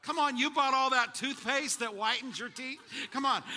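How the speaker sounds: ordinary talk, nothing marked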